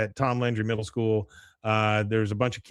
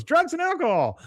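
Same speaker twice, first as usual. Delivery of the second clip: very high mock girl's voice; imitated eager schoolgirl; 3 dB louder than usual. Girl answering the class advisor, imitated eager voice. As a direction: pipe up high like a keen pupil.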